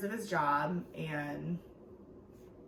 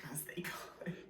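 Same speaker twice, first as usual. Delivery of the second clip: whispered aside; 3 semitones lower, 13 dB quieter, faster than usual. Real, not acted. Whispered aside after Tejas.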